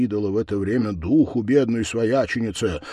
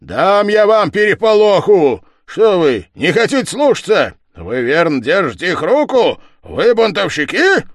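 high, loud browbeating; angry threat; 9 semitones higher, 8 dB louder than usual. Acted. Village head accuses his men, loud and angry. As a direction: berate them with raised, forceful questions.

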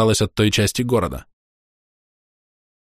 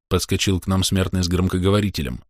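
usual speaking voice

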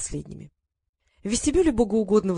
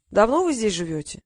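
lightly voiced old man in short phrases. A narrator's reading. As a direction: reminisce with a hint of an old man's voice. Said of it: "Он говорил короткими предложениями". (старик, короткими фразами)

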